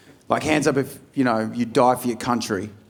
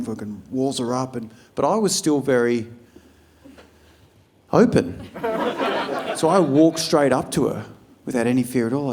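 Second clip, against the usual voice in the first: normal talking voice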